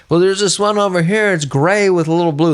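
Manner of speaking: high-pitched helpful searcher voice; imagined search crew guy. Retold or imitated. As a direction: call it in high, like an eager crewman. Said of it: "some guy's like".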